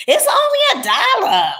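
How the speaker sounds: hammed-up dismissive shout; mocking viewer. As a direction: scoff at the cheap price like a snob. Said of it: "somebody said that on here before".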